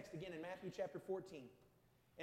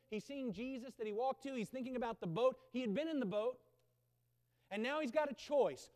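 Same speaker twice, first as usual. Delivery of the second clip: ordinary talk, nothing marked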